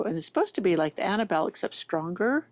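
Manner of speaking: usual speaking voice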